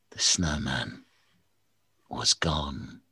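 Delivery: low, unvoiced spooky whisper; spooky hush. A narrator's reading. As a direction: hush it like a ghost story.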